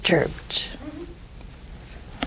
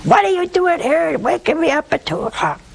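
irritated superior; 8 semitones higher than usual. Acted. Superior woken at two a.m.

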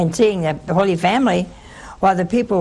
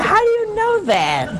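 pitched way up in disbelief; surprised; 12 semitones higher than usual. Retold or imitated. Nun asks a gloomy man, high and surprised. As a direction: ask it like you can't believe your ears. Incredulous question in retold chat.

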